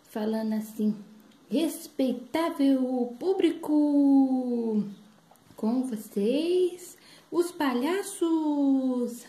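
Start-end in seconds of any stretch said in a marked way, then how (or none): 1.5-9.2 grand, very slow ringmaster call; drawn-out big-top introduction; circus announcer. Acted. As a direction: stretch every word like a ringmaster. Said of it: "aquele homem bonito, falando assim".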